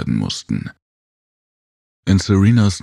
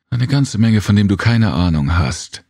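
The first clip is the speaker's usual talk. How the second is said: higher, louder, irritated retort; annoyed growl; 6 semitones higher, 3 dB louder than usual. A narrator's reading. Serena retorts to Jem's jibe, annoyed growl. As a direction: snap back, put out. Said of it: "knurrte Serena verstimmt".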